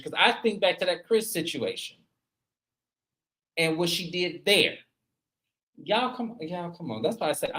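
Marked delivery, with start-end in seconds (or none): none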